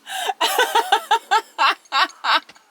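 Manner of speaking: high, loud burst of laughter; big real laugh at a joke; loud laughing fit. Real, not acted. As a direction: crack up helplessly.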